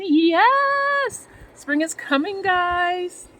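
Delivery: very high, loud, jubilant shout; a loud, high whoop of joy; excited cheer. Real, not acted. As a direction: whoop for the coming spring. Cheering about planting in ground.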